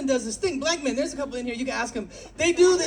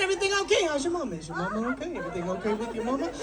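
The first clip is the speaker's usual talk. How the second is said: fading inner voice; 3 semitones lower, 6 dB quieter, faster than usual. Retold or imitated. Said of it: "inside my head".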